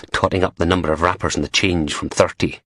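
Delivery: ordinary delivery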